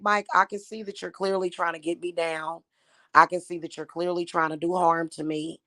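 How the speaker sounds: usual speaking voice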